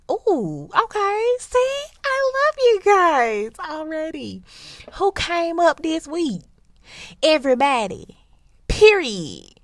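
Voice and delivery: very high and loud, excited; hyped. Real, not acted. Host imagining a segment, loud excited cheer. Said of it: "hyped segment idea".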